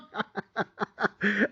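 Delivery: high laugh. Real, not acted. Laughing at imagined critics.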